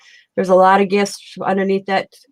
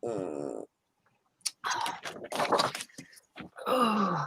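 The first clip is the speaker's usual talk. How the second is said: chewing while talking; 9 semitones lower, 14 dB quieter than usual. Real, not acted. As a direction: mouth full of food. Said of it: "I'm eating a tuna sandwich".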